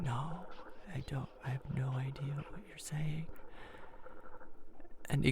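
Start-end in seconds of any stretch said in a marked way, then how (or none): 0.0-3.3 soft, breathless whispered denial; nervous whisper. Retold or imitated. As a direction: whisper it, frozen with awkwardness. Awkward silence, nervous reply.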